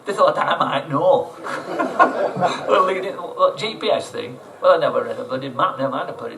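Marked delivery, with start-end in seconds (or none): 1.3-2.6 a high, airy burst of laughter; high breathy laugh. Real, not acted. Amused by the clueless crew, high breathy laugh.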